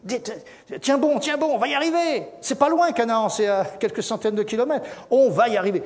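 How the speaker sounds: rally the weary walkers; rousing encouragement. Acted. Caleb cheering on the tired people, high rousing encouragement. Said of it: "il leur disait".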